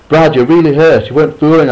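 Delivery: high, loud frantic outburst; boy blurting out at his bleeding cousin; blurted in alarm. Acted. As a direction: blurt it out in panic. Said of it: "I blurted out".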